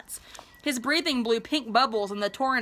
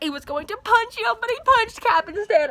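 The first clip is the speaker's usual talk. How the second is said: gasping at the plot twist; excited disbelief; 11 semitones higher, 4 dB louder than usual. Real, not acted. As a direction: voice shoots up high and louder. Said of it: "Oh my gosh".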